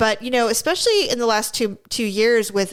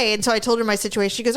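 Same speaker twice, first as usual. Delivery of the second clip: usual speaking voice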